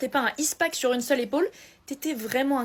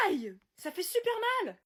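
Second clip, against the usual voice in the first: high, soft yelp of pain; mock pain cry; 5 semitones higher, 7 dB quieter than usual. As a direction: squeal as if it really hurt. Douleur soudaine. (cri de douleur aigu mais doux)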